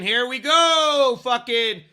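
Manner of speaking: loud, slow and stretched, pumping the crowd; hyped drawn out. Acted. Host kicking off the headlines in a loud, slow hype call. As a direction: drag it out like a hype man.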